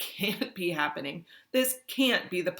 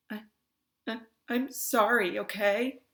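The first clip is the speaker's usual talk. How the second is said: put-on higher, stammering, teary girl; tearful apology; 7 semitones higher, 3 dB louder than usual. Davis apologising to Ludmilla, mildly tearful. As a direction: apologise through mild sniffling. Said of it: "She sniffs after every other word".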